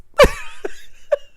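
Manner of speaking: loud squealing laugh; high-pitched laugh. Real, not acted. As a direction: burst out in a high, breathless cackle. Reacts after the awkward silence.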